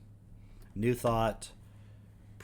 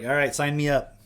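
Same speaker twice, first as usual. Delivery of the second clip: loud, higher-pitched eager quote; mock eagerness; 4 semitones higher, 9 dB louder than usual. Mocking the woman signing up, mock eagerness. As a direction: imitate someone jumping at it, loudly.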